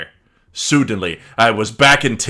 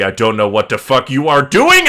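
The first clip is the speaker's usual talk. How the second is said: loud mock yell; angry shout; 3 semitones higher, 7 dB louder than usual. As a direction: shout back in comic fury. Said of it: "I shooted angrily".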